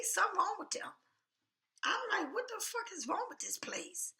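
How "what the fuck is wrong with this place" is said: high-pitched, softer mock outrage; exasperated disbelief. Shopper recalling her reaction to the store, high exasperated disbelief. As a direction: retell it as high, incredulous exasperation.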